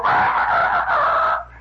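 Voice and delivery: very high, sustained loud cry; imitated rooster-like cry. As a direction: let out grandpa's crowing shriek. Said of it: "And he'd go".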